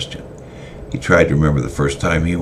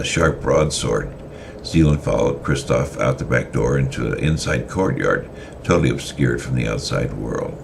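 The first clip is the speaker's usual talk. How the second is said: plain reading voice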